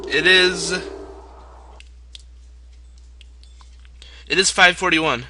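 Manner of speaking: half-shouted reply to a parent offstage; calling out the time. Real, not acted. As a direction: call the answer out to someone in another room. Answering dad calling from outside.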